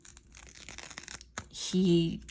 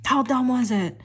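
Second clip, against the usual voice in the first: teasing; 6 semitones higher, 3 dB louder than usual. Retold or imitated. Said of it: "I was like".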